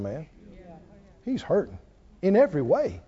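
ordinary delivery

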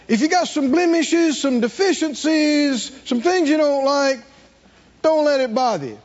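high, ringing preacher's build; preaching at full force. Acted. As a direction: lift the congregation with joyful fervor. Rising exhortation.

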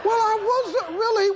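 high-pitched defensive protest. Acted. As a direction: protest your innocence up high. Acted quarrel with the devil.

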